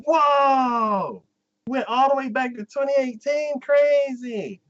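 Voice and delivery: high, loud mock wonder; mock amazement. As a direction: gasp it out high like a wowed viewer. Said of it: "And I'm like".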